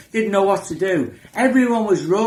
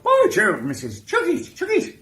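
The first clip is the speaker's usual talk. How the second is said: much higher, squeaky comic voice; 12 semitones higher than usual. Retold or imitated. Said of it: "I was doing an impression of [name]".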